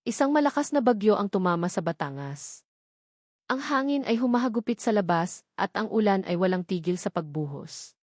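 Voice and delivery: ordinary delivery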